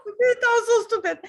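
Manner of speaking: high, loud laugh-crying over her own words. Real, not acted. Recalling her shameful remark, laughing through tears, high and loud. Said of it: "Ooh, I'm crying".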